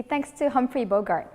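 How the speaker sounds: ordinary talk, nothing marked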